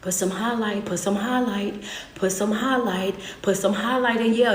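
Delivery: ordinary talk, nothing marked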